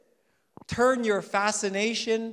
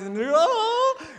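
mock ugly cry; 9 dB louder, slower than usual. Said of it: "You know the ugly cry?".

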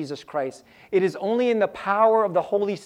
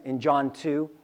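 ordinary talk

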